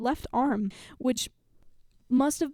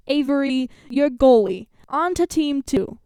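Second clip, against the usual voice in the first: loud, clear coach; 3 semitones higher, 8 dB louder, slower than usual. A narrator's reading. Coach assigning jobs to teams, loud and clear. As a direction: call out each name like a coach. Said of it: "loudly and clearly".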